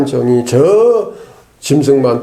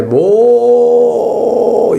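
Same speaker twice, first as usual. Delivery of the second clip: "모-" held very long and high; drawn-out syllable; 8 semitones higher than usual. Shown as a demonstration. Preacher emphasizing Moses's meekness, drawn-out "모-". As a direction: stretch out "모-" on a raised, sustained note. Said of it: "모를 좀 오래 해야 되는데".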